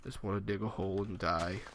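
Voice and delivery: a joking sad confession to the viewers; mock sad. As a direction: play it fake-sad, almost under your breath. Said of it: "and it's sad".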